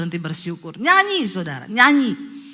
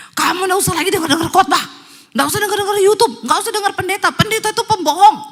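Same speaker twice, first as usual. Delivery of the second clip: high, loud, fast angry rant; possessed rant; 10 semitones higher, 8 dB louder, faster than usual. Retold or imitated. Possessed husband ranting at wife, fast furious. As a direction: spit it out fast like a raging demon.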